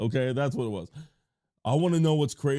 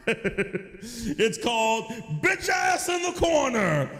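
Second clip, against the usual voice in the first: hammed-up high card-game shout, slow and laughing; Yu-Gi-Oh duelist; 10 semitones higher, slower than usual. Yu-Gi-Oh nerd punishing chatter, hammed-up duelist shout. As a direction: declare the trap card like an anime duelist. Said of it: "I was a Yu-Gi-Oh nerd".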